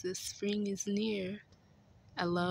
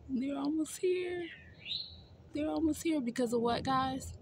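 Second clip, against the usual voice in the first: excited; 9 semitones higher than usual. Real, not acted. Excited teasing question to viewers.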